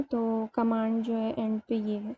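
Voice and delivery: usual speaking voice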